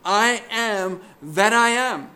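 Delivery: "I am that I am" proclaimed high and strong, each part weighted; emphatic declaration. Retold or imitated. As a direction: declare "I am that I am" with full, slow weight. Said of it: "it is emphatic".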